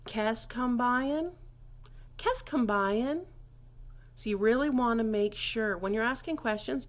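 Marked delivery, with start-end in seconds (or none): 0.1-3.3 "Kest kombuyen" slow, rising at the end; upward question inflection. Shown as a demonstration. Teacher showing how to ask the price, slow rising question. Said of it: "don't forget that upward inflection".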